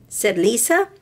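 gentle storybook read-aloud with voices; storybook reading for little girls; reading to children. A narrator's reading. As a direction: read it like bedtime story time. Reads picture book to girls.

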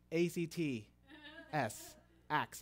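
letter by letter, with pauses; spelling it out. Retold or imitated. Churchgoers correct the new believer's spelling, letters set apart. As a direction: imitate patiently spelling for a newcomer. They spell Acts for newcomer.

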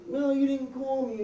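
ordinary talk

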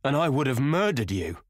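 high, appalled exclamation; shocked, impassioned outburst; horrified cry. A narrator's reading. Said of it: "he cried".

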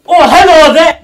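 high, loud laughing hello; loud laughing greeting. Real, not acted. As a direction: burst out a giddy hello.

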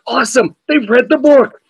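high-pitched, thrilled exclamation; delighted excitement. Retold or imitated. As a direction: replay a reader's rising delight. Thrilled reader retelling his reaction.

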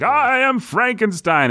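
high, over-the-top Shakespearean monster; hammy Frankenstein. Retold or imitated. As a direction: ham it up like a stage actor. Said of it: "He's like".

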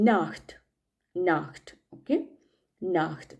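നാഹ്റ്റ് with a breathy ch before the t; German pronunciation of നാഹ്റ്റ്; German word Nacht. Shown as a demonstration. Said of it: "എന്നിൻ്റെ example noun".

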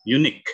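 unique said loud with a plain K at the end. Shown as a demonstration. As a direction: land unique on a clean K sound. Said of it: "bunyikan saja langsung huruf K". (unique diakhiri bunyi K yang jelas)

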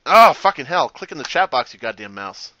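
loud burst of real irritation; exasperated outburst. Real, not acted. Player cursing the mouse over the chat box, exasperated. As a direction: snap loudly at the useless mouse.

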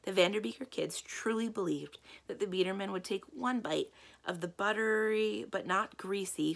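usual speaking voice

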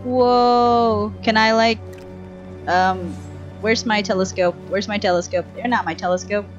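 ordinary talk

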